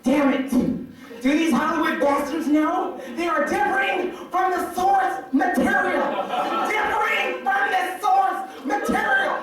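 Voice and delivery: high-pitched, drawn-out mock indignation; hammed-up fanboy outrage, words stretched; ranting fan. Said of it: "he's starting to curse and everything".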